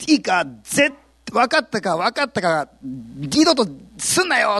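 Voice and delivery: a hint of a bullying guard; threatening jailer. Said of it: "って言って脅されて".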